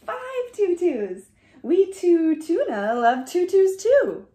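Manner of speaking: usual speaking voice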